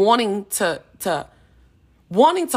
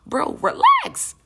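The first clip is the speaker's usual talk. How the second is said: squeaky high relax; much slower than usual. Acted. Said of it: "Sit in this room and just relax".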